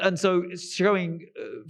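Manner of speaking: usual speaking voice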